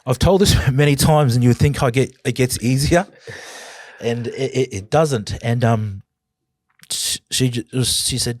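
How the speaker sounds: a real catch of tears as the memory hits; voice breaking. Real, not acted. To the host, reliving his daughter's tears, voice cracking. Retelling his suicide attempt, choking up.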